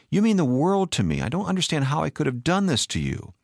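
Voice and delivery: high-pitched, urgent plea; remorseful appeal, pitched high; desperate pleading. A narrator's reading. Husband pleads with wife.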